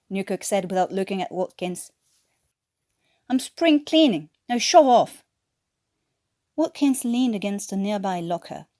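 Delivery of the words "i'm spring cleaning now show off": mock curt snap. A narrator's reading. Newkirk snaps back at Watkins.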